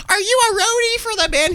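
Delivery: screechy-high mock yell; hammed-up high-pitched demand; yelling lady. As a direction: imitate an irate woman in a very high voice. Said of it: "She yells at the guitarist".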